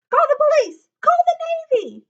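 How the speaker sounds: high, loud frantic cries; panicked yelling. A narrator's reading. Coach panicking at the creature, screaming for help.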